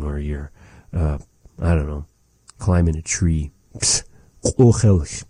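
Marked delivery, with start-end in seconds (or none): none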